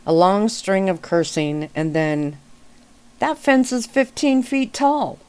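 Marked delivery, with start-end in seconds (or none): 3.2-5.2 much higher, stunned exasperation; exasperated disbelief. A narrator's reading. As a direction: hit fence hard in disbelief. Cursing, then exasperated.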